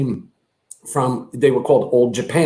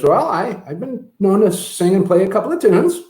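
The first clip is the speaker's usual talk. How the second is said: higher, put-on modest singer voice; 6 semitones higher than usual. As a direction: answer coyly as the famous singer. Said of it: "So [name] came down".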